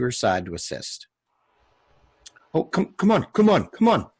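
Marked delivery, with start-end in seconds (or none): 2.5-4.1 high, hurried coaxing from the panicked woman; frantic urging. Acted. Panicked woman urging everyone to hurry with the child. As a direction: hurry them along, breathless with panic.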